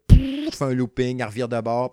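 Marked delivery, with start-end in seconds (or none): 0.1-0.5 loud mouth-made burst of air; explosive vocal whoosh; whooshing ship noise. Acted. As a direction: imitate a ship shooting off. Imitates the ship boosting into loop.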